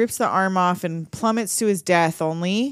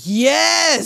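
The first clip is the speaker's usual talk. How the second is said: higher, louder gleeful yes; mock triumphant yes; 7 semitones higher, 5 dB louder than usual. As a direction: cheer like the plan just worked. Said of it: "And he's like".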